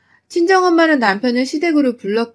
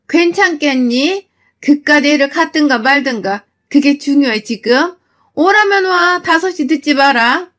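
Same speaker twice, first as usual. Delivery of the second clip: mother-in-law cutting down her daughter-in-law, higher and scornful; contemptuous scolding; 4 semitones higher, 4 dB louder than usual. Acted.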